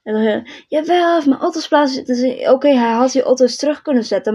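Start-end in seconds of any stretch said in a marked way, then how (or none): 0.7-2.1 high, fast imitation of an angry neighbour; hurried, mocking comeback; mock indignant retort. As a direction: mimic her protest quickly, without really yelling. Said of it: "je hoeft niet zo te schreeuwen".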